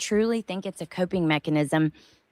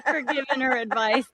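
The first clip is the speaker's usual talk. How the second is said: real amusement, higher pitch; laughing; 6 semitones higher than usual. Real, not acted. Laughing at Bindi getting it.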